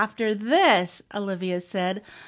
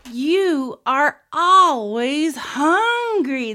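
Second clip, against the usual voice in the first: loud, slow, drawn-out teasing; higher, amused mock scolding; laughing; 5 semitones higher, 8 dB louder, much slower than usual. A narrator's reading. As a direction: tease in chorus, every word stretched. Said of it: "they all said, laughing".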